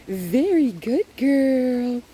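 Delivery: high, loud, drawn-out praise; happy voice. Shown as a demonstration. Trainer praises the dog, high happy voice. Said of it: "we like the nice, happy voice".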